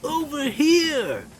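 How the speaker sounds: calling out. Acted. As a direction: lure him over with a high call. Hidden voice calling Dudley.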